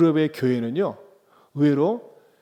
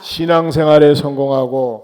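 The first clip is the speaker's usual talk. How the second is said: loud, measured call to echo; feed the line loudly to the crowd; lead for repetition; 9 dB louder than usual. Shown as a demonstration. Preacher gives congregation the next phrase, loud leading call. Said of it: "따라서 합시다".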